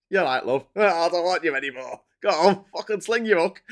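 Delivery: a laughing, hammed-up Yorkshire put-down; mock Yorkshire local telling Truss to leave, laughing through it. Constituents voiced as Yorkshire folk, cheeky Yorkshire accent. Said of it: "sound like they were from Yorkshire".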